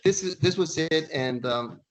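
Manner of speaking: ordinary talk, nothing marked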